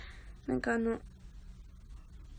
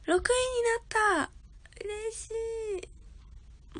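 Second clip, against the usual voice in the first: much higher, slow, nearly tearful with joy; overjoyed; 10 semitones higher, slower than usual. Real, not acted. Streamer thrilled at reaching sixth place, near tears. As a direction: let the happiness crack the voice.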